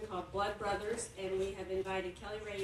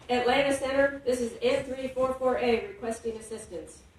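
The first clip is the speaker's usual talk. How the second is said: read-aloud opening, radio call a touch louder; story reading; 3 semitones higher, 8 dB louder than usual. Acted. Host filling in for a sick author, reading aloud. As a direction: launch the excerpt with a pilot's call.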